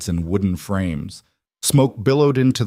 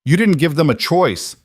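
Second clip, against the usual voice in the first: higher and louder in protest; rising voice; 8 semitones higher, 4 dB louder than usual. A narrator's reading. Cole defying the captain, voice rising high and loud. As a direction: raise your voice against him. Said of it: "Cole's voice rose".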